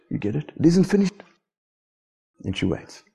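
ordinary delivery

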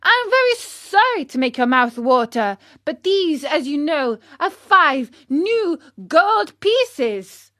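high, emphatic puppet retort; indignant Pinocchio, boasting. Acted. Pinocchio showing his gold to the scoffers, indignantly.

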